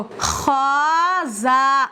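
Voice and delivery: "खा जा" stretched out very slowly, each letter name drawn long; slow letter reading. Shown as a demonstration. A teacher shows students how to read joined letters, very slow. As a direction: spell out "खा जा" slowly and clearly for the learners. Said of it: "इस तरह पढ़ेंगे फिर हम इसको".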